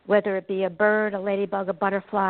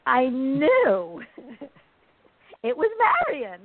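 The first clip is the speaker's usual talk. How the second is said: laughing; 5 semitones higher, slower than usual. Real, not acted. Laughs recalling the sign.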